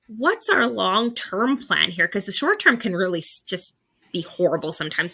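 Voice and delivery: ordinary delivery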